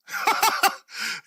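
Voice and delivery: a high burst of laughter; short delighted high cackle. Real, not acted. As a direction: laugh up high at your own joke. Laughing at own punchline.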